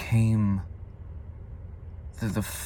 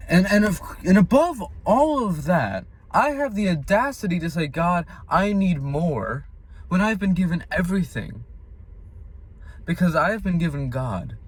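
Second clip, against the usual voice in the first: raised, emphatic preaching pitch; impassioned confession; 8 semitones higher than usual. Real, not acted. Confessing he asked God for more, impassioned. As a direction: testify with incredulity at your own audacity. Preaches about his own ingratitude.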